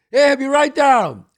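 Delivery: high, loud and slow call down; mother calling back. Retold or imitated. Mother answering from upstairs, calling out loud. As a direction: sing out cheerfully from the window. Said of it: "his mother would stick her head out".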